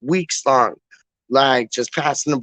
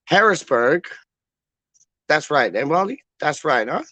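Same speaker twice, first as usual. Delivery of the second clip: ordinary talk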